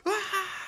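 a quick high mock scream; childhood scream. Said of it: "just be like".

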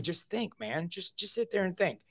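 quietly coaching a friend to just sit and think; calm sponsor. Retold or imitated. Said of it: "'cause he told me".

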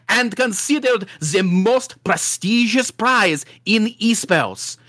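put-on hammy French accent that keeps turning Russian; overplayed mock French accent, laughing through; bad French accent. Bad French accent, reading the trophy case announcement. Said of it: "don't know what accent that is".

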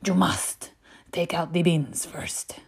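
put-on slow, hushed, accented order; imitation of a stern mother; strict mum. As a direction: lay down the law slowly, almost whispered. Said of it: "And she's like".

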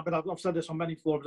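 ordinary talk, nothing marked

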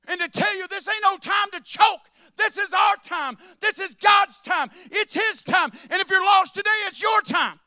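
loud, high shouted preaching, slowing at the end; fiery sermon climax. Real, not acted. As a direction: shout it to stir them up.

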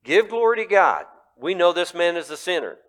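loud, high commanding rulers; stern demand. Acted. Rulers pressing the healed man, loud demand. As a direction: bark it like an interrogator. Rulers voiced again, louder.

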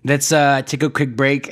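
usual speaking voice

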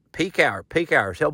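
ordinary talk

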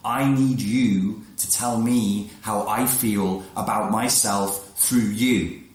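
slow voiced inner plea; slow mock plea. Girl needing a mirror for her feelings, slow mock plea. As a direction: draw it out as the needy girl. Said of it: "distinction is subtle".

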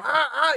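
two sharp, high, loud yelps of panic; panicked screams. Retold or imitated. Retelling the beach scare, he screams loud and high. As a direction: scream like a kid fleeing something in the water. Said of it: "Y fue como".

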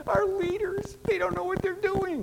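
high, mocking whine about the leaders; grumbling complaint. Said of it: "They complained".

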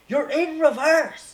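high, drawn-out shout of a boat rental worker; megaphone yell. Retold or imitated. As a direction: bellow it slowly, as if through a megaphone. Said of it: "a megaphone, and they're like".

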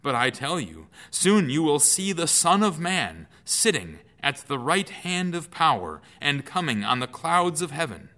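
high, loud proclamation; a prophet facing his judges; bold declaration. Acted. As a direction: proclaim it with rising force. Jesus declares before council.

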